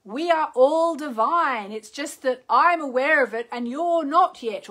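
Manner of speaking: loud, high teacher's voice; Amma. Acted. Host quoting her Indian guru on divinity, loud and high. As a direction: declare it emphatically, lifted and deliberate. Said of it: "Amma actually says".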